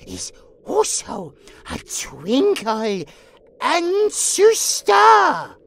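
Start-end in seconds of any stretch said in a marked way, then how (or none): 3.6-5.5 "ancestor." stretched slow and emphatic; slow, big reveal on "ancestor."; ANCESTOR drawn out. Acted. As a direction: proclaim "ancestor." syllable by syllable.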